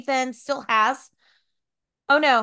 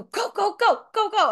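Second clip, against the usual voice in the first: excited urging; 9 semitones higher, 4 dB louder than usual. Retold or imitated. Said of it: "they go".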